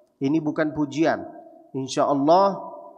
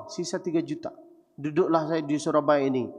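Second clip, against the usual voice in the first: ordinary delivery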